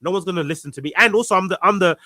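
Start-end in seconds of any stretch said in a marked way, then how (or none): none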